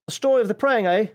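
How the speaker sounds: put-on half-hearted Australian accent. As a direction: read it with a hint of Australian. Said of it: "not gonna do a bad Australian accent".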